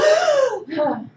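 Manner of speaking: sharp loud high gasp of alarm; startled acted gasp. Shown as a demonstration. Acts out the alarm reaction.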